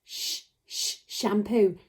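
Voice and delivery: "sh-, sh-" unvoiced, then full "shampoo"; sounding out sh. Shown as a demonstration. Teacher asking if children hear it, "sh-, sh-, shampoo" sounded out. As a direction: repeat the hushed "sh-" onset before "shampoo". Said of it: "Can you hear the sound in sh".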